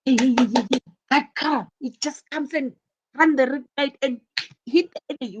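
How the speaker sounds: high, loud and fast calling out the crash; excited alarm. Shown as a demonstration. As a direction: shout it like a startled witness. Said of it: "You say".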